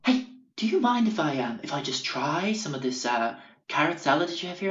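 high, sing-song hippie voice; imitated hippie customer. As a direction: ask in a put-on, high-pitched hippie voice. Imitating hippie customer asking for food.

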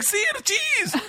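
high, giggly and thrilled; laughing excitement. Retold or imitated. Student repeating the gangster's order, laughing and thrilled. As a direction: squeal it through a laugh.

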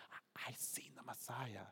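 secretive whisper. Retold or imitated. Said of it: "You can just see her".

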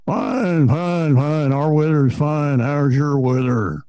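put-on loud, slow, gruff old coach; old coach impression. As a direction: boom it out slowly like an old coach. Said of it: "And Howard goes".

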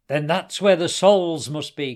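loud, excited realisation; urgent cry. A narrator's reading. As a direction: cry it out loudly. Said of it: "cried Hassan".

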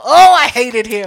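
slow, loud groan of dislike; exasperated venting. Real, not acted. Storyteller recalling the pushy man, exasperated venting. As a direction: drag it out loudly in exasperation.

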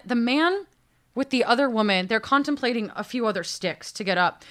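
plain reading voice

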